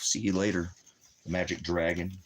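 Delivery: ordinary delivery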